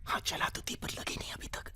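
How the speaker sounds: whispered, very soft admission; hushed confiding. Acted. Answering the boss about a shot fired, hushed whisper. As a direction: drop to a whisper.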